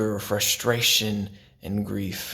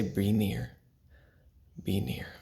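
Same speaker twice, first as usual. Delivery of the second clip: soft breath prayer; 7 dB quieter than usual. Shown as a demonstration. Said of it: "when I breathe out, I say".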